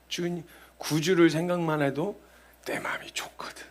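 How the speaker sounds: soft breathy whisper. Real, not acted. Preacher confessing peace in the Lord, in a whisper. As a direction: hush it like a secret.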